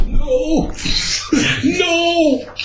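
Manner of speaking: very high, pleading no with a laugh; high squealing no. Retold or imitated. Dreading his ride arriving, high squealed no. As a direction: whimper it up high.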